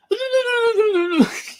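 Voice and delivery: very high, long fake wail; mock baby bawling. Streamer mocks someone crying over Dobby, high baby wail. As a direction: bawl like a baby in mockery. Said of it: "bawled like a baby".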